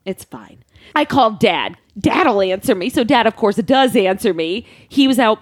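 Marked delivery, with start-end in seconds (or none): 0.0-0.6 much softer and lower, telling herself it's fine; barely voiced, calming yourself; quiet self-reassurance. When mom won't answer the call, quiet self-reassurance.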